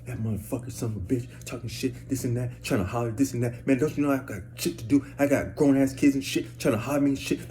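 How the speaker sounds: imitated woman grumbling curses under her breath; mock mutter, rising in pitch as she complains; ranting woman. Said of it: "But she can".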